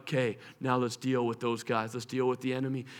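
low, soft, menacing; quiet vengeful threat; movie avenger. Acted. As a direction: growl it like an action hero. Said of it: "they're like".

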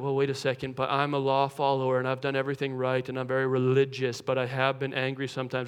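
low, soft, put-on voice of a fussy law follower; flustered rule-follower. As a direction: protest in a deep, hushed mock voice. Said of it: "You got a few people like".

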